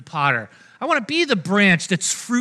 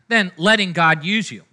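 usual speaking voice

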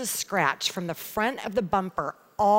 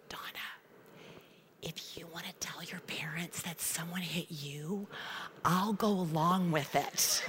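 unvoiced secret whisper into her ear; grandfather's hushed whisper, slowing at the end; 3 semitones lower, 10 dB quieter than usual. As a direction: lean in and whisper it like a conspirator. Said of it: "he whispers".

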